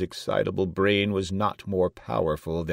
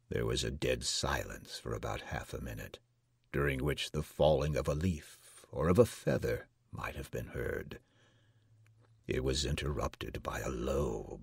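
plain reading voice

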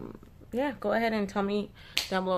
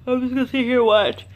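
words stretched by a real yawn, louder; 5 semitones higher, 6 dB louder than usual. Real, not acted. Apologizing to viewers while settling down to watch, yawning. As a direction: yawn right through the sentence. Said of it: "why did I keep yawning".

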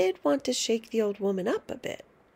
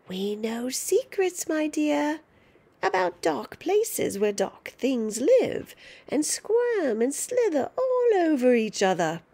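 high, louder, cackling-edged threat; gloating witch grandma; 6 semitones higher, 4 dB louder than usual. Acted. Grandma taunting George about a tail, high gloating witch. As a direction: relish scaring the child.

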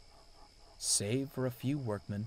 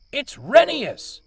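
high, slow, very loud bellow; 13 dB louder, slower than usual. A narrator's reading. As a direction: roar the name, drawn out. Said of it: "bellowed Sir".

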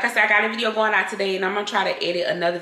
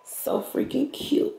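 awed whisper; 8 semitones higher, 6 dB quieter, slower than usual. Real, not acted. Admiring island up close.

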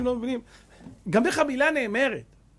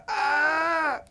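burst of laughter; 8 semitones higher than usual. Retold or imitated. Wife bursts laughing at joke. (פרץ צחוק רם)

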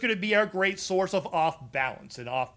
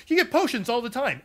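said through laughter, higher; laughing repeat; 6 semitones higher than usual. Real, not acted. Streamer repeating his impression line, laughing. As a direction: repeat the line while still giggling. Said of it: "That's my Zydone".

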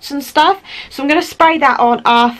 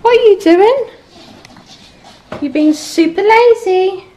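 pet voice; 8 semitones higher, 3 dB louder than usual. Real, not acted. Talking to the lazy puppies.

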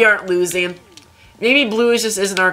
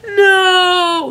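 anguished cry; 10 semitones higher, 5 dB louder than usual. Real, not acted. Losing again.

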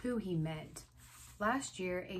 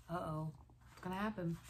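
usual speaking voice

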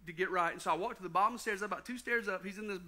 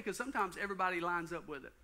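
normal talking voice

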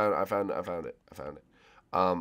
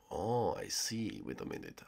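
whisper; 3 semitones lower, 12 dB quieter, slower than usual. Real, not acted. Hiding from seeker, whispering.

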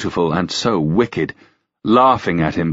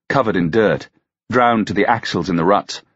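plain reading voice

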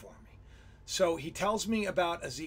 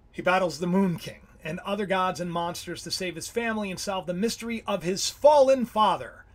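ordinary delivery

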